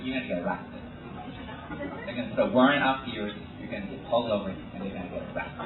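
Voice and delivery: low, soft, rapid imitation; retold warning. Girl warning him about a jury duty warrant, low quick murmur. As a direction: rattle off the warning quietly and low.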